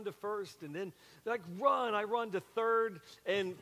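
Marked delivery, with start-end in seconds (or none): 1.6-1.9 high called-out run; calling across the playground; retold call to run. Kids on the playground telling the new kid to run.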